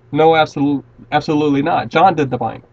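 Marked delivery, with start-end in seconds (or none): none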